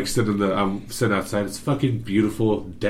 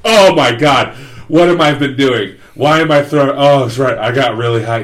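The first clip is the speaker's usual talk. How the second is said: much louder and higher, startled; alarmed realization; 4 semitones higher, 8 dB louder than usual. Retold or imitated. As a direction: blurt it as it dawns on you. Reliving realizing he was sick.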